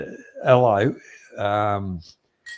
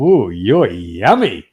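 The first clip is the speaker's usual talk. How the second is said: relishing; 2 semitones lower, 5 dB louder, slower than usual. Retold or imitated. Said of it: "I lit one up and I thought".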